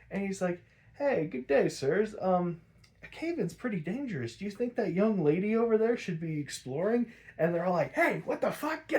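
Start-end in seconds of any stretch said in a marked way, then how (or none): 1.0-7.1 higher, overly polite gentleman voice; butter up the workers, pitched up and proper; mock polite gentleman. Bruce distracting the workers with mock politeness. 7.9-8.9 much higher, startled outrage; workers' outraged yell. Retold or imitated. Workers turning on Max in shocked outrage.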